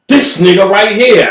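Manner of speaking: high, loud indignant cry; indignant outburst. Retold or imitated. Embarrassed Ellis fuming, a high, loud indignant cry. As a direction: bristle at him.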